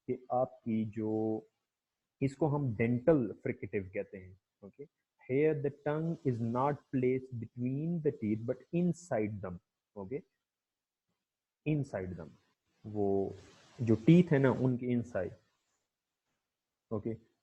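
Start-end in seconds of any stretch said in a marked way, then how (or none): 5.3-12.3 the definition read out very slowly. Shown as a demonstration. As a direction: read word by word as a slow dictation. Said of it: "description में repeat कर देता हूँ". (किताब से बहुत धीरे पढ़ना)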